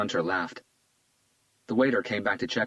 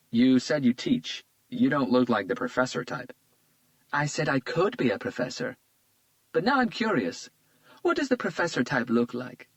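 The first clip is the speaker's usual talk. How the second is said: put-on lightly raised, charmed young woman; a flattered young woman, a bit higher; Isabella's voice; 6 semitones higher than usual. A narrator's reading. Isabella charmed by Hunter's compliment, lightly higher voice.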